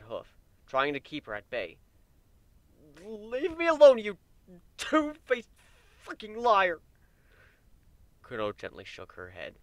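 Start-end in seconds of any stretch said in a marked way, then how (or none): 3.0-6.8 much higher and louder furious yell; furious outburst. A narrator's reading. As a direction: lash out, spitting the insult. Warren shoves her away, cursing.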